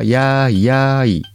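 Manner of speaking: ordinary talk, nothing marked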